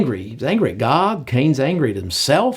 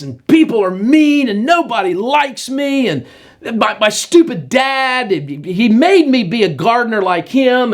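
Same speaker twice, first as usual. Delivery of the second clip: sulky Cain; 6 semitones higher than usual. Acted. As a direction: whine like a sulking teenager. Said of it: "And this horrible attitude".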